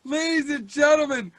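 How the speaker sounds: a loud, showy opening call to the room; a loud announcer's opener; announcer intro. Acted. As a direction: open the show like an MC. Host opening the show.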